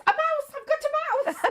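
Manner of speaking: squealed high and loud, half laughing; the lady caller shrieks with glee at finding a mouse; excited caller. Retold or imitated. Retelling a helpline call, caller squeals with delight. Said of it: "you get the cursor when you move the mouse".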